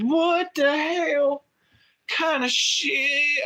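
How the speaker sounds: high, slow, drawn-out outraged disbelief; stunned disbelief. Retold or imitated. Boy told it's only a movie, high slow disbelief. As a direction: stretch it out like a kid who can't believe it. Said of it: "And I said".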